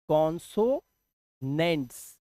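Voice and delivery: कॉन्सोनेंट्स slowly broken as "kon-so... nents"; syllable-by-syllable demonstration. Shown as a demonstration. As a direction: draw out कॉन्सोनेंट्स in parts, low and soft. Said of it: "क्या बोलते हैं?". (कॉन्सोनेंट्स को धीरे-धीरे तोड़कर, "कॉन-सो... नेंट्स")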